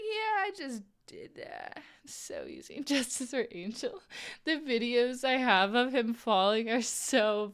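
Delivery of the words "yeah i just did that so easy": higher, slow, pleased with herself; smug brag. Retold or imitated. Skier bragging after a clean run, slow and smug. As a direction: savor the brag, drawn out.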